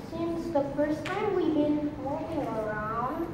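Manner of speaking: soft, low, halting ask; hesitant request. Acted. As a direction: ease gently into asking Mom for a favor. Monologue: son timidly asks mom for money.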